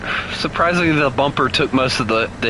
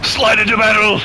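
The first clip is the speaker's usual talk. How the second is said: battle cry; 9 semitones higher, 4 dB louder than usual. Pretend. Batman battle cry in game.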